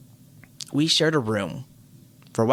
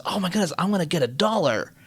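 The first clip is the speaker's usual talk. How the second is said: higher-pitched retold excitement; excited little brother; 6 semitones higher than usual. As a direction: imitate a kid thrilled about his reward. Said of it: "but he's excited".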